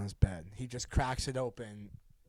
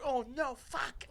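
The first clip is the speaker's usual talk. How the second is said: panicked; 12 semitones higher, slower than usual. Retold or imitated. Freaking out.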